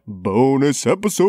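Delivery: eerie horror-host voice; spooky voice. Pretend. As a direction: say it like a haunted-house announcer. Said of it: "I love the spooky voice".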